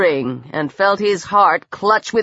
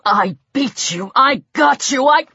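mounting triumph; 2 semitones higher than usual. A narrator's reading. Peter gloats over dying Lang, building in triumph. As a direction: build fast, then get cut off.

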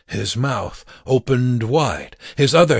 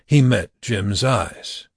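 ordinary delivery

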